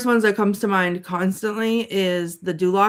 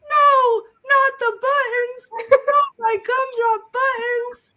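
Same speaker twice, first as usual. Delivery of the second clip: very high, squeaky cartoon pleading; hammed-up squealing plea, drawn out at the end; mock panic; 14 semitones higher, 4 dB louder than usual. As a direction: wail it like a tiny frightened captive. Captive pleads in the scene.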